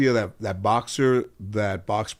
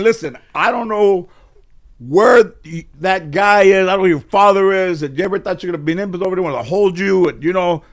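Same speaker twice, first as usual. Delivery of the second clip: angry relative; 8 semitones higher, 6 dB louder than usual. Retold or imitated. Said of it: "When somebody's saying".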